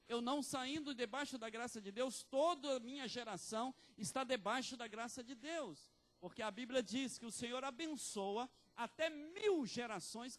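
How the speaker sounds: loud, high preaching climax; full-force pulpit declaration; fiery preaching. Acted. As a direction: proclaim it to the whole church.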